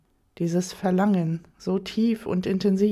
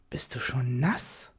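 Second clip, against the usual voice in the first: low, slow whispered tease; surprised grinning whisper; 4 semitones lower, 4 dB quieter than usual. A narrator's reading. Lover teasing her in bed, low grinning whisper. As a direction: whisper it with a grin. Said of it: "fragt er verwundert, grinsend".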